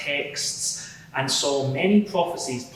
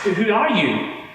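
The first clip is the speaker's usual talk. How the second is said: startled cry; 8 semitones higher, 5 dB louder than usual. Retold or imitated. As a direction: voice jumps higher and louder, alarmed. Retelling his cry on the road.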